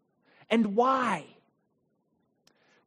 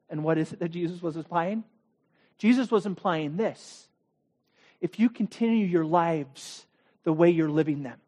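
usual speaking voice

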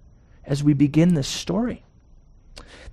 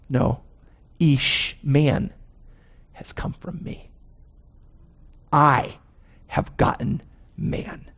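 proud quote; 4 dB quieter, slower than usual. Retold or imitated. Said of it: "a proud response by woman".

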